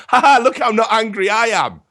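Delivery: high, loud sarcastic mockery; mock fake calm. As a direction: sneer like someone pretending not to be angry. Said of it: "try and make out".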